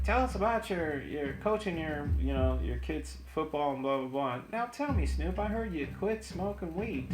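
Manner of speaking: mock the host's interview questions; Jay Leno interviewing. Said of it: "he's like".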